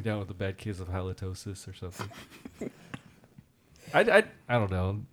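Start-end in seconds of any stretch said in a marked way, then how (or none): none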